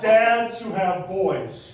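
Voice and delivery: very slow, spelled-out correction; slow right down and spell it out; slowed clarification. Real, not acted. Comic correcting the audience's daddy joke, very slow. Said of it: "not that kind of daddy".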